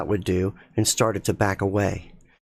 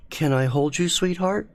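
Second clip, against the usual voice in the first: tender husband; 7 semitones higher than usual. Acted. Mark asks to hold her.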